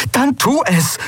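very high, impatient command; urgent order. Acted. Endriel tells Aylan to act now, impatient. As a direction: demand action at once.

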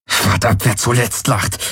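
hissed curse through tight anger. A narrator's reading. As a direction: hiss it at your captor. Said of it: "zischte Garland". (wütend gezischt)

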